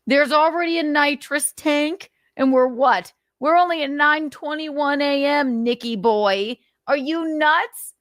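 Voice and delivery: high, disbelieving rant at him; incredulous scolding. Real, not acted. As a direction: scold him like he's lost it. Scolding Nick about nitrous tank.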